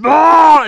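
loud, high mock monster outburst; sudden mock transformation yell; wolfing-out roar. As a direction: burst into a cartoonish wolfman roar. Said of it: "Wolf's out on the phone".

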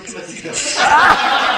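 laughing. Real, not acted. Laughing loud reply.

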